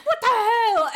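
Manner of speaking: high, loud indignant outburst with laughter underneath; hammed-up outraged exclamation; mock anger. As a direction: imitate someone pissed off, amused. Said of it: "angry, okay?".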